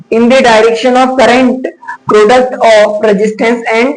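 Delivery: very slow, each word spaced for note-taking; teacher reading a definition aloud slowly; slow dictation. Shown as a demonstration. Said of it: "पहला नियम".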